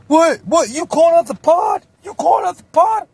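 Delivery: high, loud incredulous shout; high shrieking disbelief at the challenger; shocked mock yell. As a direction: yell it like you can't believe he dared. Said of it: "Jake's like".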